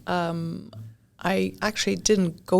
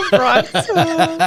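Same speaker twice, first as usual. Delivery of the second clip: the name "Bronx" higher and louder through laughter; laughing; 10 semitones higher, 8 dB louder, slower than usual. Real, not acted. Guest laughing at the host's Bronx middle-name joke. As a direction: crack up at the joke.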